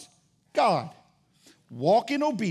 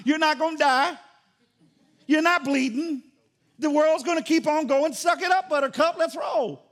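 raised-pitch, brusque mock parenting; tough-love dad; 5 semitones higher, 3 dB louder than usual. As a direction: tell a crying kid to toughen up. Tough-love father over crying child.

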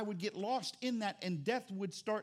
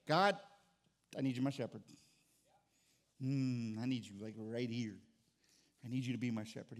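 intimate prayer; 9 semitones lower than usual. Acted. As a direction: pray it close and tender. Voicing a quiet prayer.

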